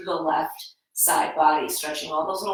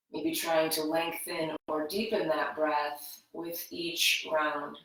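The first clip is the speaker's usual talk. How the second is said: guided meditation; 5 dB quieter than usual. Acted. Yoga teacher guiding students into breath awareness, soft meditative voice. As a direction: ease them into their breath gently.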